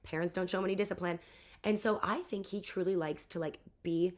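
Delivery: normal talking voice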